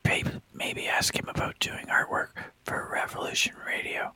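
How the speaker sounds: unvoiced whisper to a friend; whispering on the phone. Real, not acted. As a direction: whisper it so the guest can't hear. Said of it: "I'm whispering in your".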